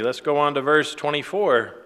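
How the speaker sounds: ordinary talk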